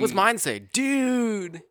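high, drawn-out stoner catchphrase; movie stoner quote. Acted. Acting out the movie's tattoo bit with co-host, stoner quote. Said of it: "What was the other tattoo in Dude, Where's My Car?".

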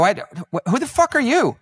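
high, loud retold disbelief; mock incredulous challenge. Host confronts the mistaken guest, mock incredulous. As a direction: act out a baffled, rude demand.

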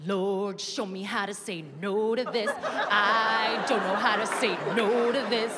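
a politician begging God for willpower he doesn't want; tempted Hamilton. Retold or imitated. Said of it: "he's like".

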